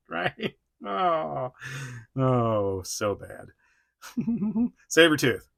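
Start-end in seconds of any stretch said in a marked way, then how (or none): none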